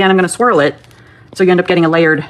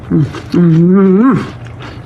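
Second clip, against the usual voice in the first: savoring mm sounds; much slower than usual. Real, not acted. Tasting the dipped cookie.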